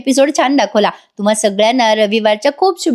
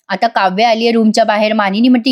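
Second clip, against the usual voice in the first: usual speaking voice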